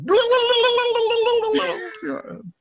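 high warbling turkey gobble sound. Acted. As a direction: gobble like a turkey, shrill and loud. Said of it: "all I know is turkey sounds".

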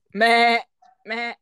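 loud, plain Filipino goat bleat; Philippine goat accent. Shown as a demonstration. Demonstrating goat accents to a friend, loud Philippine bleat. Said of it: "Dito sa Pilipinas".

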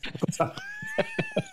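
high squealing laugh at his own joke; burst of laughter. Real, not acted. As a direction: crack up, high and helpless. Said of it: "Say that without laughing then".

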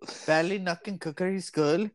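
put-on tentative Irish accent try. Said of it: "sing-songy kind of way, like it goes up and down".

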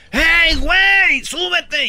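high, loud call, drawn out; retold shout. Tourists on the bus yelling at the man to get in. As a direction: yell at him to climb back in. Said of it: "le gritan del camión".

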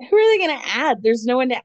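high, loud disbelief; incredulous. Real, not acted. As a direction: ask it like it's absurd. Disbelief at a cast shakeup.

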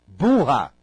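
imitating a scolding of the fussy one. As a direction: snap the insult at her.

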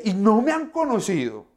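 hammed-up exasperation; exasperated scolding. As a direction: throw up your hands at friends who still don't get it. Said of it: "Yo no me imagino yo respondiéndoles de esta manera".

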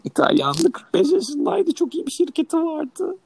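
high, slow put-on voice for the student; squeaky mock voice, drawn out; mock Italian student voice.

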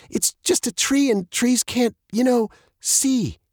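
put-on high, halting girl's voice; stammering. A narrator's reading. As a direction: falter towards the end, searching for the word. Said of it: "Maddie stammered".

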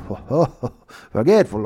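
laughing through the words, teasing; mock laughing. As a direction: chuckle out forgetful at him. Guards laughing at him.

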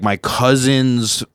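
normal talking voice

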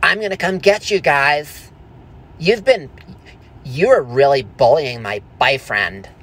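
mock bully-confronting outburst; mock boyfriend threat. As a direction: play the boyfriend coming to get them. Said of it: "He's like".

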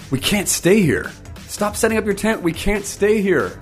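high-pitched, stretched-out call to move on; urgent plea. Acted. Reformer urging people to stop setting up tents, urgent plea. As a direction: plead urgently, pitched well above normal.

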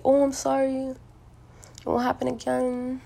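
high, sing-song retold apology; mock shocked apology. People caught touching her apologize to her, mock shocked. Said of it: "their faces get shocked".

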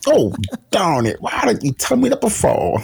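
hammed-up Deep South outrage; deep south accent. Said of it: "Deep South accent is, like, pretty good".

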